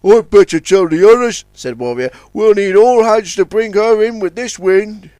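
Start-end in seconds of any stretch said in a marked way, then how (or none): 0.0-1.4 louder tug voice, a touch higher; Warrior the tug. A narrator's reading. Tug Warrior rallying the fleet, louder. 2.3-5.1 louder tug voice, a touch higher; Warrior the tug. A narrator's reading. Tug Warrior rallying the fleet, louder.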